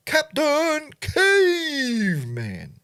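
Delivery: high, slow drawn-out cartoon shout of Captain Caveman; Captain Caveman cartoon call. To the chat, hamming up the cartoon hero's call. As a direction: bellow the name like the cartoon hero. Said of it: "Remember that cartoon?".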